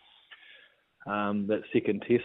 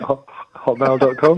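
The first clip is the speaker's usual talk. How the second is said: teasing announcement; 5 semitones higher, 10 dB louder than usual. Acted. Teasing reveal of embarrassing email.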